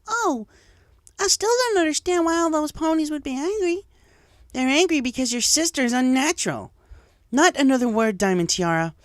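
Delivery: high, heated schoolyard voices; arguing fillies. Acted. Fillies argue in class, high and heated. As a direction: fillies trading barbs.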